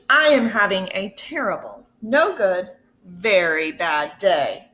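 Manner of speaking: ordinary delivery, nothing marked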